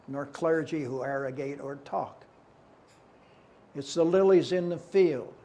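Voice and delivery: declaimed verse, long pauses between lines; poem recitation. Acted. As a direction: proclaim it firmly, line by line.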